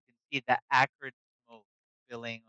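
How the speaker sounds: ordinary delivery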